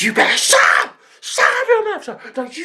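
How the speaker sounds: high-pitched imitation of an outraged rant; hysterical retort. Dumped girl blaming auntie, hysterical retort. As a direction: wail it high, outraged and wronged. Retold screaming quarrel, shut up.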